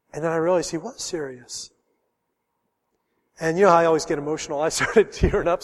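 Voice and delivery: ordinary delivery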